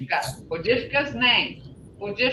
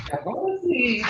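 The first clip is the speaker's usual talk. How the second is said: tired tone; 3 semitones higher, 4 dB quieter than usual. Shown as a demonstration. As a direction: draw out the last syllable as if tired. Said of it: "Like you're tired".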